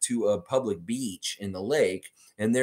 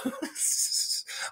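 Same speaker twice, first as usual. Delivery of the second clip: laughing; 7 dB quieter than usual. Real, not acted. Reacting to viewer comment.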